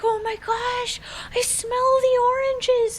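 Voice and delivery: high-pitched, drawn-out mock amazement; mock overexcited riders. Imitating the family over the orange groves, mock overexcitement. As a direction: imitate a thrilled tourist squealing out. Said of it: "They're like".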